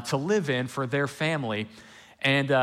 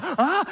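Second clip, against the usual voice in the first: put-on a high yelled "Ah!" of two drowning kids; retold scream; 5 dB louder than usual. Preacher acting out his childhood scream in the lake. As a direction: cry out high as if slipping under the water. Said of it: "And we start screaming".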